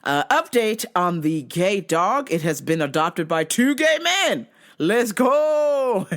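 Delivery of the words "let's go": drawn-out higher cheer; celebrating. Real, not acted. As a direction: whoop it out in celebration. Celebrates happy dog news.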